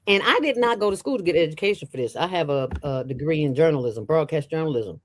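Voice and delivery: normal talking voice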